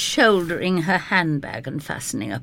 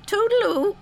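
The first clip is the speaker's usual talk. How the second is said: bright, higher, firm goodbye; determined; 4 dB louder than usual. Acted. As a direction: say goodbye and mean it. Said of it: "in a very determined tone".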